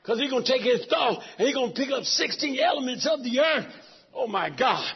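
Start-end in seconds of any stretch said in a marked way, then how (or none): none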